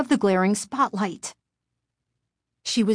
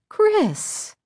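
high, scandalized teacher cry; shocked exclamation. A narrator's reading. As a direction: exclaim high in horror at a pupil.

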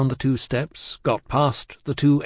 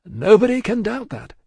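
high, eager agreement; relieved, placating concession; delighted admission; 10 semitones higher, 4 dB louder than usual. A narrator's reading. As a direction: grant it gladly to please him. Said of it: "delighted to be able to make another admission".

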